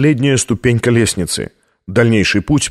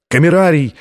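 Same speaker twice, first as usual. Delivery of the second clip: higher-pitched call after someone; narrated shout; 5 semitones higher than usual. A narrator's reading. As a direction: call out urgently, without full volume. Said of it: "кричал Лэнгдон".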